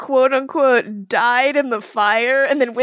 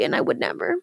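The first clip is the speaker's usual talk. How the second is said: whispered aside; 12 semitones lower, 6 dB quieter, faster than usual. Real, not acted. Trailing joke under breath.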